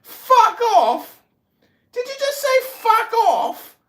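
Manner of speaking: high, loud disbelieving repeat; outraged disbelief at fuck off; incredulous echo. Real, not acted. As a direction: fling his words back, astonished.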